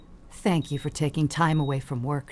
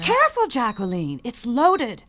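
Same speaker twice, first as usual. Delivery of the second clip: sharp, louder and higher alarmed warning; 6 semitones higher, 7 dB louder than usual. Acted. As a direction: warn her fast before the gun goes off. Daughter handles loaded pistol.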